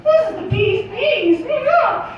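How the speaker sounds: high, giddy cartoon falsetto; high-pitched mouse voice, laughing; Mickey Mouse. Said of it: "He's like".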